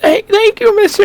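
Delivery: put-on very high little-kid voice; high-pitched kid addressing a grown-up; grateful child. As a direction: squeak out thanks like a small child. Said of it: "he looks at you and he says".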